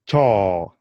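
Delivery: "chaw" with an open aw, not oh; Swahili o vowel. Shown as a demonstration. Teacher modelling Swahili word for toilet. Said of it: "Can you try and pronounce that?".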